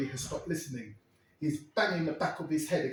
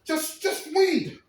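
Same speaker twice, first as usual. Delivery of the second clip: high pleading; 11 semitones higher, 6 dB louder, much slower than usual. Retold or imitated. Terry pleading.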